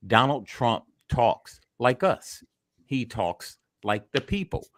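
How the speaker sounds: ordinary delivery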